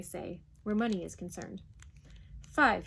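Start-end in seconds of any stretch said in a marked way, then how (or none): 2.5-2.9 loud one-word counteroffer; roaring. A narrator's reading. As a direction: roar out the price. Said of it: "he roared".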